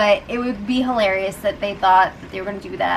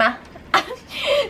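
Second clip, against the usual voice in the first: a squeaky, awkward giggle; nervous high laugh; 13 semitones higher, 3 dB quieter than usual. Retold or imitated. Fessy caught out by Caitlyn, awkward high giggle. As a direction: laugh like you've been caught.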